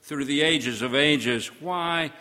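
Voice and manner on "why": slowed, searching question; slow, weighty. Acted. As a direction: linger on every word.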